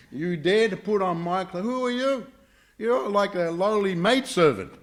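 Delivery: high, indignant, contemptuous scolding; acted anger. Brahmana's daughter belittling the princess, acting very angry. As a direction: put an inferior in her place, pitched high. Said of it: "Devayani became very angry".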